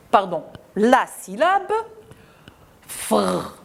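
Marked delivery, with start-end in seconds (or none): 2.9-3.6 soft, isolated fr sound before adding oui. Shown as a demonstration. Teacher isolating the fr blend for children. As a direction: sound out just the consonants fr, quietly. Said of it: "oui, fruit".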